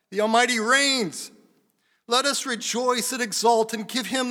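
high, thunderous shout of praise; crying out. Acted. Multitude rejoicing at the Lamb's marriage, loud exultant crying out. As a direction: roar it out like a great multitude. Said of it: "crying out".

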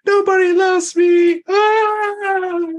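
very high, slow, loud melodramatic shout; over-the-top shrieking anime sob; anime crying wail. Acted. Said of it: "anime tears coming out sideways".

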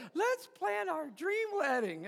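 high sweet doll voice; Barbie voice box. Retold or imitated. G I Joe speaking with Barbie's voice box, high. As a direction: chirp it like a talking doll. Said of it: "and he goes".

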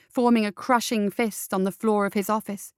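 ordinary delivery

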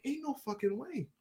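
high, very soft voice; stunned disbelief. Retold or imitated. Fan seeing the press event graphic, stunned disbelief.

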